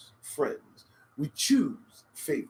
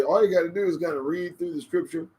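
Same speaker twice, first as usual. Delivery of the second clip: ordinary talk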